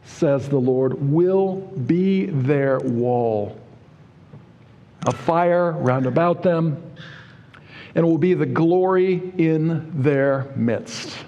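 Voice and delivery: drawn-out, solemn King James delivery; slow scripture reading. Acted. Preacher reads God's promise to congregation, slow solemn reading. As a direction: let each phrase of the promise land slowly.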